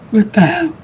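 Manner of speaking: usual speaking voice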